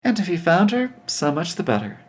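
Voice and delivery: plain reading voice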